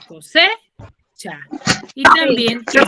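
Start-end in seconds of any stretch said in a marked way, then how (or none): 0.1-1.4 "cosecha" drawn out very slowly, leaning on se; teacher stretches "cosecha" to show where the stress falls; slow stress demo. Shown as a demonstration. Teacher confirms the stress to the class, slowly. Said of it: "En la C".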